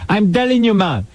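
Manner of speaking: high, drawn-out mock Jamaican; bad Jamaican accent. Mock witness describes the man to Bill, bad Jamaican accent. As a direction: exaggerate a fake Jamaican witness. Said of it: "Worst Jamaican accent I ever heard".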